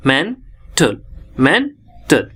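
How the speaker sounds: "मेंटल" in two parts, men then tal; split into syllables. Shown as a demonstration. Teacher counting two syllables in "मेंटल", said split. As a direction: pause between the syllables of "मेंटल". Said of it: "दो syllable का है".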